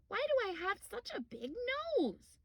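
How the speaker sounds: high, small cub voice; little bear voice. Acted. Little Bear asking Grandma a question, small high voice. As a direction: squeak it out like a curious cub. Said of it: "asked Little Bear suddenly".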